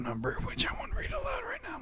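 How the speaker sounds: hushed whisper. Pretend. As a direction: whisper it like a secret. Joking aside about phone number.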